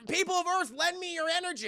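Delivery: high heroic call to the world; rally the planet, lightly voiced hero; Goku impression. Goku asks Earth for energy, high heroic call.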